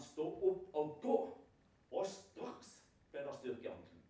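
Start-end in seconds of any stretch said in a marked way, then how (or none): 0.0-1.2 healing command. Retold or imitated. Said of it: "Så igjen".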